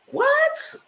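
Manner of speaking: shrieking high disbelief; a stunned high squeak; shocked what. Retold or imitated. Reaction to the confession.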